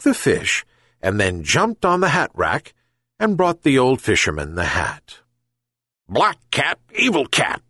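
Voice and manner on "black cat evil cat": high, yelped accusation; yelping dog. A narrator's reading. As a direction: yelp it like an indignant dog. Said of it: "yelped the dog".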